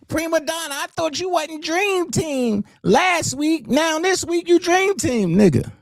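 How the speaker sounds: mimicking the critics who flip-flopped on the Dream Team, in a high sneer; mocking the haters. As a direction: parrot the haters in a silly high voice.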